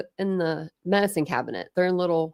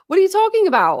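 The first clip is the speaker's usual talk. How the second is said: higher and louder, mock outrage; telling off the troll at the pool, higher and louder; exasperated disbelief; 12 semitones higher, 6 dB louder than usual. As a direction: push back hard, baffled. Retold argument with troll.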